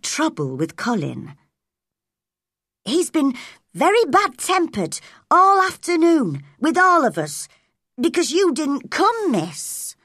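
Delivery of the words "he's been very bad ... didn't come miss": put-on servant girl voice, raised pitch; a servant reporting to the young miss; Martha. A narrator's reading. Servant Martha tells Mary about Colin, lightly voiced.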